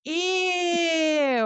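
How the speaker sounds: put-on a high, drawn-out childish "Ew"; mock disgust. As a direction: act out a kid grossed out by the idea. Son's disgusted reaction voiced.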